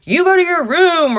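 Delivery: high, loud snapping mom; mock angry parent. Mom scolding her son to his room, mock angry. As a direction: bark the order like a fed-up mom. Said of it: "had I reacted to him and been like".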